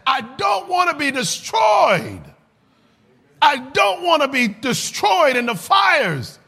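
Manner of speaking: high, slow, shouted desperate cry; high desperate wail, stretched out slowly; frantic plea. Retold or imitated. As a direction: plead like a terrified boy begging not to be destroyed. Frantic boy pleading in church.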